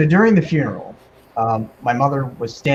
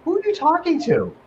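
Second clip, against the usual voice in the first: puzzled mother; 13 semitones higher than usual. Retold or imitated. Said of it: "and my mother said".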